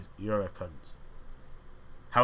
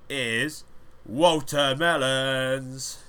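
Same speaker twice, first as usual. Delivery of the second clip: slow, drawn-out, louder winner reveal; mock award announcement; 4 semitones higher, 5 dB louder, much slower than usual. Host revealing the award winner, in a drawn-out announcer call. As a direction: stretch the name like a game show host.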